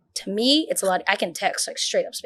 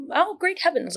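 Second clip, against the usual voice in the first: mock Shakespearean; 9 semitones higher, 4 dB quieter than usual. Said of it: "like Shakespeare".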